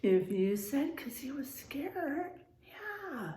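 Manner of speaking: soft unvoiced aside to the class; whispered hint. Real, not acted. Teacher whispering a hint to the children. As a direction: lean in and whisper the answer. Teacher hints answer softly.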